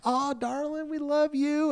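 put-on high-pitched, gushing Southern accent; Southern sweetness. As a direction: imitate a sugary Southern greeting. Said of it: "When I live down south".

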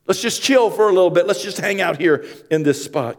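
high, loud, building preacher voice; preaching at full force. Acted. Pastor preaching an Easter sermon to the congregation, at full force. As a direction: preach it out to the whole church.

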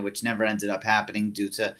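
ordinary delivery

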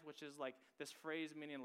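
ordinary delivery

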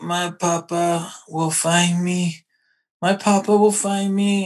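a hint of a small boy's pleading voice, drawn out; imitating Pinocchio. Retelling the movie to a friend, a light puppet-boy impression. Hanged puppet's line retold.

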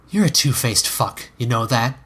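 ordinary delivery, nothing marked

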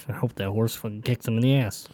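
soft, spiteful grumble; trance mumbling. Acted. Tranced player wishing a kick on his companion, mumbling. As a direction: mutter an ill wish under your breath. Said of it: "Can I mumble while I trance?".

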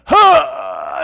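loud, high gasp of lost breath; imitated wind knocked out. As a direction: gasp as if flattened on your back.

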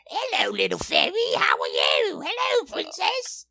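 high, overbearing mock greeting in a put-on voice; lunatic performers. Face-painted lunatics accost a girl in fairy wings, hammed-up cooing calls. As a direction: imitate an over-the-top costumed character greeting a child. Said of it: "all of these fucking lunatics in".

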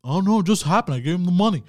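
hammed-up innocent teen; playing innocent. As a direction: act clueless to hide being high. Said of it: "I just told them".

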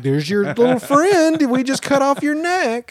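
high, slow sugary vet voice; mock vet voice. Mocking a vet returning a tumor, high and slow. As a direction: play the vet handing it over sweetly, slowly. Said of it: "be like".

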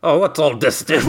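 British bobby. Retold or imitated. Cop poking round a crime scene, mock British bobby accent. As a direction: do the stock English copper line.

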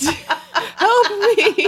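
high, laughing plea; mock cry for help. Person drowning in pistachios begging for help, high mock panic. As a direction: play at drowning, squealing through laughter.

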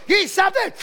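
high loud begging shout; wife in labor begging, high shout; desperate yell. Retold or imitated. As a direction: cry out for relief. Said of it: "she was getting crazy".

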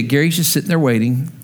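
ordinary talk

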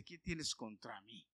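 slow, drawn-out demon plea; demon pleading. Retold or imitated. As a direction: let the demon beg slowly. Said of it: "el demonio viene y le dice".

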